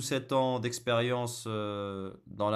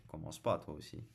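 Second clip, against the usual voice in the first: ordinary talk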